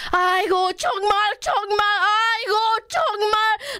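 put-on exasperated Korean ajumma; imitated scolding. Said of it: "like, yelling at us".

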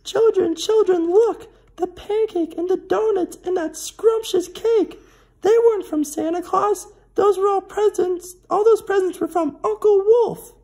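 very high, loud squawking hen voice; screeching chicken. A narrator's reading. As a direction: screech it like an excited mother hen. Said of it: "the chicken screeched".

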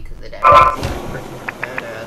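loud, pumped declaration; hyped up. Real, not acted. Gamer praising the dad character, loud and hyped. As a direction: sell how badass he is.

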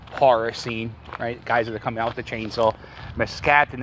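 usual speaking voice

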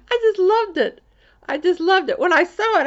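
high, loud, gushing delight; real delight. Real, not acted. Showing viewers the gumdrop tree a friend drew, delighted. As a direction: burst out high and loud, thrilled by the gift.

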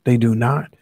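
usual speaking voice

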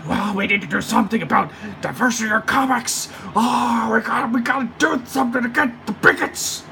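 high, whiny put-on voice mocking activists, ending in a shout; mock SJW voice. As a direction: sneer it out like a preachy activist, building to a yell. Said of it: "just basically just like".

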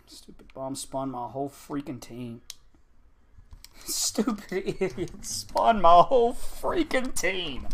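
very soft, defeated anger; real quiet disbelief; seething mutter. Real, not acted. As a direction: grumble under your breath. Muttering after losing a play.

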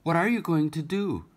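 put-on high, eager child voice; a small girl calling to her mother; little girl crying out. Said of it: "cried the little girl".

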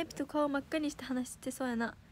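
normal talking voice

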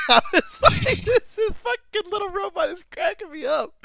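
very high laugh-filled delight. Real, not acted. The speaker cracking up at the little robot, very high. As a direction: squeal it through laughter.

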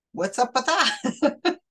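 high, slow laughing question; laughing disbelief. Real, not acted. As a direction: chuckle through the question in amused disbelief. Laughing at the skeptic's catch.

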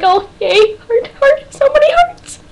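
very high, gushing squeal; hammed-up lovestruck gush; fangirl swooning.

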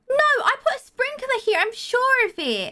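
high-pitched, protesting wail; dismayed. Real, not acted. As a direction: wail in disbelief over the plants. Dismay at dying plants.

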